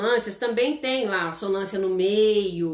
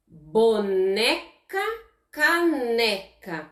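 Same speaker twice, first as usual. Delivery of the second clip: slow rhyme demo; much slower than usual. Shown as a demonstration. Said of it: "Termina tudo com neca".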